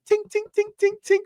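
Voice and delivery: very high, quick pinging; ping it like metal on glass; imitated metal clinking. Imitating metal smacked on a window, clinking. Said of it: "like someone smacking metal on a window".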